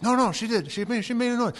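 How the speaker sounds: mock the wife who is sure; wife insisting she heard the baby; insistent wife.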